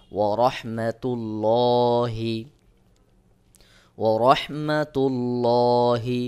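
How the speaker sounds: slow "রহমাতুল্লাহি" with heavy, rounded, long "lo"; thick Allah. Shown as a demonstration. Teacher models the full-mouthed Allah in "রহমাতুল্লাহি", drawn out. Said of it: "মোটা করে পড়তে হবে".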